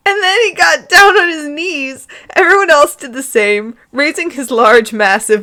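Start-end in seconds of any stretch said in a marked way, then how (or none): 0.1-2.1 higher and louder, cracking up mid-sentence; reading through real laughter; laughing. Real, not acted. Reader breaking up laughing at the kneeling scene.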